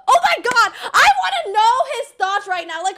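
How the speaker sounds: high, loud exasperated rant; loud, high-pitched outburst of scorn; heated rant. Real, not acted. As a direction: vent about a hated ex, pitch flying up. Ranting about toxic ex-boyfriend.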